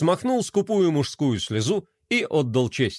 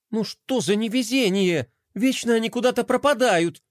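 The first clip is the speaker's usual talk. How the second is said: put-on grumbling boy; 7 semitones higher than usual. A narrator's reading. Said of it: "пробормотал мальчик".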